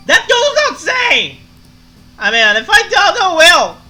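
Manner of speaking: put-on very loud, high drunk shouting; bellowing defiantly after a few drinks. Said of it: "to make the drunk voice".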